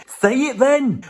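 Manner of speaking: high mock heckle. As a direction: bark it at yourself as a heckler. Heckling himself.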